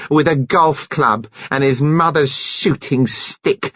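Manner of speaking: slow, seething, building rage; enraged. A narrator's reading. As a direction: spit it out in fury. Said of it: "beside herself with rage".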